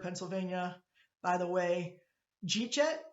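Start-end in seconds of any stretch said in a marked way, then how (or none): 2.5-3.0 Jeet jet run together Pittsburgh style; Pittsburgh slur. Shown as a demonstration. Teacher quizzing the class in a Pittsburgh accent. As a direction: squeeze did you eat yet into two syllables in a Pittsburgh accent. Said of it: "you don't know what that means".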